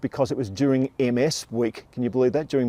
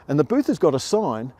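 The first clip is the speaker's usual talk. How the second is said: ordinary delivery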